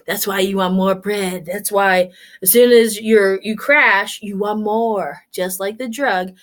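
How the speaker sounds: ordinary talk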